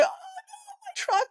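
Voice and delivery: high, panicked yelp as her truck slides off; a driver watching her truck slide away on ice, crying out in panic. Retold or imitated. Other driver sees truck sliding away.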